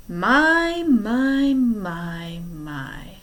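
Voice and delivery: tut-tut like a fake-shocked villain; mock surprise. Stefano feigning surprise at the body, sly falling voice.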